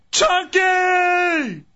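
high yelled call across a lot; shouting. Acted. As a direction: holler the name at the top of your voice. Calling him from outside.

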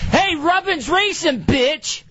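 high-pitched mock trash-talk yell; acted yelling. Gamer re-enacting screaming at the NASCAR game, acted yelling. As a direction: holler it high and drawn out, like at a rival driver. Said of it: "I'm yelling, I'm screaming".

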